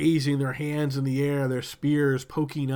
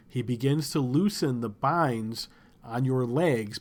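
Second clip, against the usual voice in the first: ordinary talk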